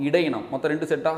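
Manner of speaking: ordinary talk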